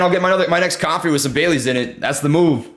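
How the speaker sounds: ordinary delivery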